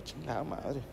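put-on a boy dreading his dad, cursing softly; muttered curse. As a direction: sigh it out under your breath. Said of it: "Y yo".

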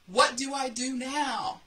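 high, loud, slowed call down from the pole; yelling. Retold or imitated. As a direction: shout down for help, drawn out. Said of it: "And so I yelled".

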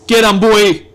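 loud, forceful cheer. Real, not acted. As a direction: call it out to spur him on. Repeats cheer with more force.

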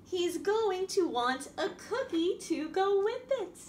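lively picture-book reading voice; reading to children. A narrator's reading. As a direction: read it out to a room of little kids.

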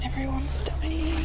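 slow breathy whisper of doom. Real, not acted. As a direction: whisper it slowly, defeated. Team dying, hushed despair.

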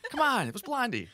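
high, loud and laughing; laughing exclamation. Real, not acted. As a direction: exclaim it through a big laugh. Laughing over his own celebrity story.

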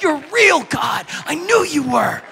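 put-on shouted high, thrilled little kid; excited child shout. Child shouting thanks to God after catching the lizard, thrilled. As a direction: yell it like a kid whose prayer came true.

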